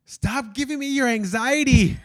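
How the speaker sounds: high, loud imitated outburst; mock-shriek it, drawing the words out; hammed-up frantic yell; letting it all out. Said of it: "let it all out for a second".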